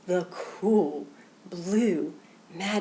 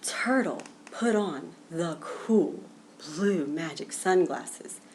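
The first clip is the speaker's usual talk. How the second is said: plain reading voice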